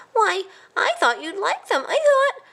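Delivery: high, rising, near tears; bewildered mouse. A narrator's reading. A hurt cousin asks why, high and teary. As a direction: plead in confusion.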